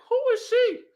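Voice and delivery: very high-pitched mimicry of a partner; incredulous imitated question. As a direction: squeak the question out in mock bewilderment. Quotes partner's puzzled question.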